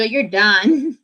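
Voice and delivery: usual speaking voice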